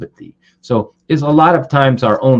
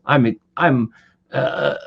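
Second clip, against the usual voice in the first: normal talking voice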